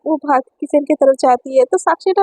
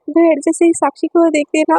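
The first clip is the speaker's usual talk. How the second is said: plain reading voice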